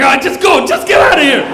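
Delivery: acted cop's fed-up yell; exasperated dismissal. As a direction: shout them away, giving up. Cop gives up, sends them off.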